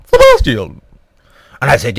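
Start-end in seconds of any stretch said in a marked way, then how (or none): 0.1-0.8 very high, loud falsetto call; sudden piping falsetto; high-pitched Queen. Retold or imitated. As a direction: squeal the name like a caricature queen. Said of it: "of course, it was more like a".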